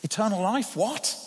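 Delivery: usual speaking voice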